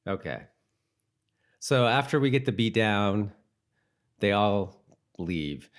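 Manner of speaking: normal talking voice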